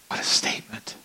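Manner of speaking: breathy awed whisper; whispered aside to the congregation. Real, not acted. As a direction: hush it as if in awe.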